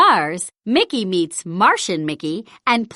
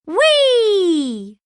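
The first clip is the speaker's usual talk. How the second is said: whee; 10 semitones higher than usual. A narrator's reading. Friends freed from the web.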